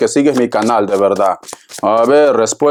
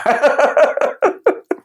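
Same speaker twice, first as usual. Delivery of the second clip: high laugh; 12 semitones higher than usual. Real, not acted. Laughs at own joke.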